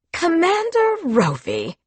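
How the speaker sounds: high, spat out with disgust; spat with contempt. Acted. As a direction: spit the name like poison. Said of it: "She spat his name like it was poison".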